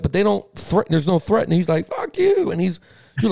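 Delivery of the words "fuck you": high-pitched mock retort; defiant kid. Retelling a kid talking back to parents, high mock defiance. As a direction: imitate a bratty comeback, pitched way up.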